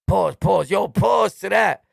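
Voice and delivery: loud, slowing mock call-out; mocking stupid slang. Imitating slang users before intelligent people, mocking call-out. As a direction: mimic a loud, ignorant interrupter. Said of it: "You sound stupid".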